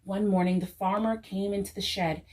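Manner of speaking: plain reading voice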